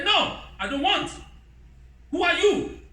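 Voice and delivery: high, indignant refusal; mock defiant wife. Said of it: "Sarah can say".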